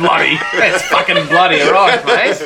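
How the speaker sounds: hammed-up, boisterous Cockney; Cockney accent. Shown as a demonstration. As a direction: lay on the Cockney thick. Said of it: "in a Cockney accent".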